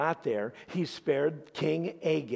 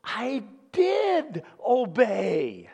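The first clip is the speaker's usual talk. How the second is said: stubborn denial; 8 semitones higher, 5 dB louder, much slower than usual. Retold or imitated. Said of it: "But he goes".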